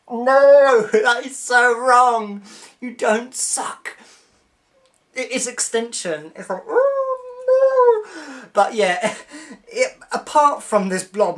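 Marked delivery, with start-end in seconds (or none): 0.1-2.4 loud, high, slow cry of disgust; disgusted protest. Real, not acted. Disgusted at Doctor sucking blob. 6.7-8.5 very high, drawn-out oh no; disgusted wail. Real, not acted. Reacting with disgust.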